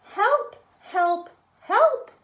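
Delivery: a small truck's urgent cry; crying for help. A narrator's reading. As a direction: call out like a stuck little truck. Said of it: "cried the little blue truck".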